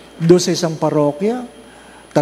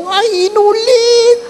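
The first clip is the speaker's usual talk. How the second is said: high, slow shrieking parishioner; hammed-up high shriek of "Ay, inulit!"; mimicked shout; 5 dB louder, slower than usual. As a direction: shriek it like the odd man in the pew.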